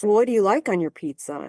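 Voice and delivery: ordinary talk, nothing marked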